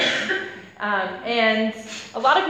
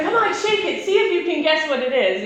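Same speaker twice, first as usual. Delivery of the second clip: put-on much higher, bouncy kid voice egging her on; pushy kid brother; 4 semitones higher than usual. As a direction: coax her like an impatient eleven-year-old. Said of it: "he's like".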